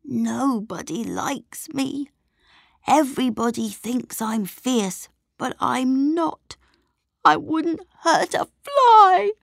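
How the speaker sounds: a gentle monster near tears, pleading; lonely monster protesting to Jack, tearful; sobbing. Acted. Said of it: "he sobbed".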